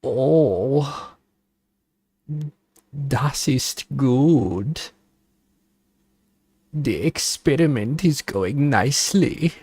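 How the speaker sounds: a playful mock German accent as a mad scientist. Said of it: "a zesty German accent".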